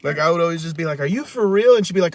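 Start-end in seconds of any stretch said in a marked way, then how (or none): 1.0-1.8 high, loud mock disbelief; retold incredulous challenge. Kid arguing with mom, loud retold incredulity. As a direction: act out an outraged retort.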